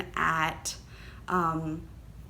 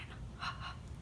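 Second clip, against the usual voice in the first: excited gasp; 16 dB quieter than usual. Real, not acted. Gasping at Wonder Woman yarn.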